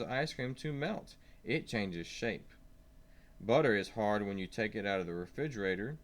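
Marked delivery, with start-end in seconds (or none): none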